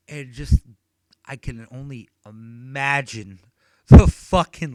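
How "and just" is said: ordinary talk, nothing marked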